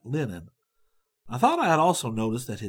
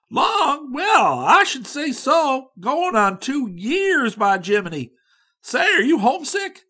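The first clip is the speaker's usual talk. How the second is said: eager drawl; 11 semitones higher, 8 dB louder than usual. A narrator's reading. Young man answers eagerly.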